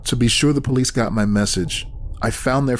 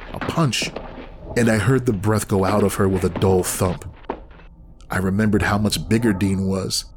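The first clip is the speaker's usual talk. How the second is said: usual speaking voice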